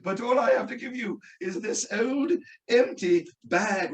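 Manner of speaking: slow mock British wizard; quasi-British dialect. Wizard confessing empty gift to wife, slow quasi-British. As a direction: stretch it out in hammed-up British. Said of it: "he had a quasi-British dialect".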